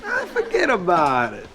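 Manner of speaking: a cartoon Italian accent brushing it off. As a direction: wave it off like a wiseguy. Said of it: "Kill the stereotypical accent".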